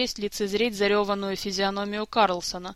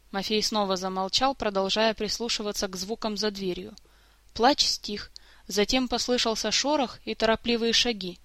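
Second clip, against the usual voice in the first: ordinary delivery, nothing marked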